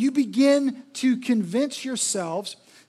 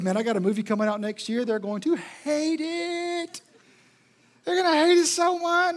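gleeful Satan; 5 semitones higher than usual. Retold or imitated. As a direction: crow like the devil delighted with himself. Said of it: "what Jesus knows is / Not Jesus, Satan".

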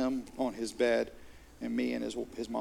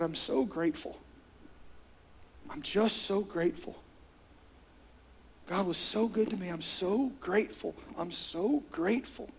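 higher, softer, tearful voice of his son; retold through tears; 5 semitones higher, 3 dB quieter than usual. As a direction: gently imitate the son's grateful weeping. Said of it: "Through tears".

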